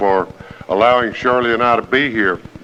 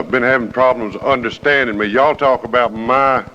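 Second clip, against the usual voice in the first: ordinary talk, nothing marked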